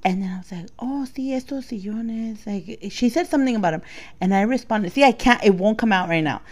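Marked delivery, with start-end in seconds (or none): 0.8-2.5 imitated Latin accent. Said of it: "whole transaction with an accent".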